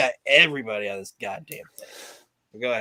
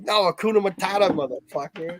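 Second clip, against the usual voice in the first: mock snap; 5 semitones higher than usual. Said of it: "he finally fucking snaps".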